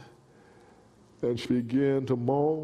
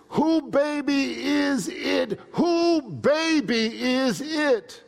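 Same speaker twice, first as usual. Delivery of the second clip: loud, slow, high pressing question; slow shouted demand; 11 semitones higher, 4 dB louder, slower than usual. Retold or imitated. Therapist shouting a slow demand at Angus.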